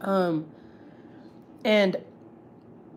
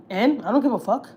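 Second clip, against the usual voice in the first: raised, louder mock dismissal; attitude; 5 semitones higher, 5 dB louder than usual. Mom brushes off her son's call, with attitude. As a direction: imitate a mother brushing her son off. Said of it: "stop giving me attitude".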